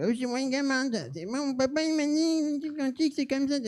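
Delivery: put-on very high, soft squeaky kid. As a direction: ham up a silly little boy, dragging the words. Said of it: "je prends une voix de gamin". (petite voix de gamin ridicule)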